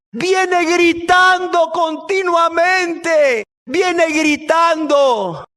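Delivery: high, very drawn-out outcry; loud, stretched-out acted grievance; exasperated complaint. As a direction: wail each word long and high. Disciples complain of her shouting.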